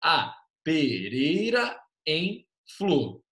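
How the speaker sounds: very slow, divided into grammatical syllables; separated syllables. Shown as a demonstration. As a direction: spell the line out piece by piece. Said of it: "a gente separou assim". (bem devagar, separando as sílabas gramaticais)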